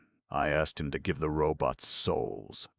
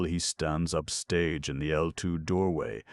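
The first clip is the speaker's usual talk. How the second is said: usual speaking voice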